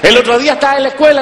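ordinary talk, nothing marked

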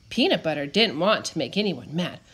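usual speaking voice

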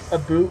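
"a boot" for "about", oo vowel; Canadian pronunciation. Shown as a demonstration. Explaining what the friend doesn't say, Canadian "a boot". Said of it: "he says it in a Canadian accent".

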